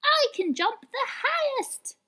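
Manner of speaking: very high, slow bragging squeak; little sister showing off; tiny boastful rabbit. A narrator's reading. Smallest rabbit boasting to her brothers, very high. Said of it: "said the smallest rabbit".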